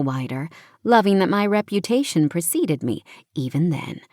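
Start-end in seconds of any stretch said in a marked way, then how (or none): none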